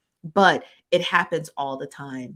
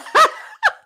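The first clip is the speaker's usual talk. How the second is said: shrieking high laugh; 9 dB louder than usual. Real, not acted. Vlogger cracks up at a comment, high laugh. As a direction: burst out laughing.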